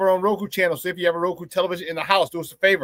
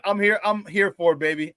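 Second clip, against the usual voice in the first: usual speaking voice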